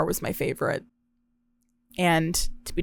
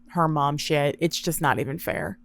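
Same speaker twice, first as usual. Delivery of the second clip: ordinary talk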